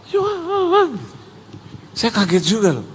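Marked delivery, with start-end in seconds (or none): 0.1-0.9 high, breath-filled drawn-out cry; imitated awestruck gasp. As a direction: gasp the name like a stunned crowd. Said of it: "semua jemaat gini". (meniru jemaat terperangah, tinggi tertahan)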